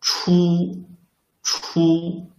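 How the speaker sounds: single out 出 so the initial is heard; isolated syllable demo. Shown as a demonstration. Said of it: "Which one is right?".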